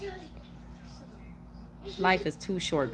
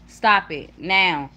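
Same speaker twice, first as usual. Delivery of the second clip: slow, firm command, louder; real scolding; 5 dB louder, much slower than usual. Real, not acted. Mother telling rowdy children to stop, firm scolding. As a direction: space it out sternly and raise the volume.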